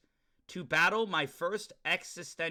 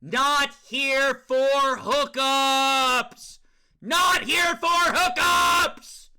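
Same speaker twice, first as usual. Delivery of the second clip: slow, stretched, much higher and louder shout; drawn-out mock yell, building higher; all-caps shout; 9 semitones higher, 10 dB louder, much slower than usual. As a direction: yell every word like capital letters. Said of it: "In all caps".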